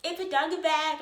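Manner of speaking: high, loud mock-mom voice; imitating mom. Mom to her returning child, high loud imitation. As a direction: exclaim it like an over-the-top mom. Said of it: "like, my mom is like that".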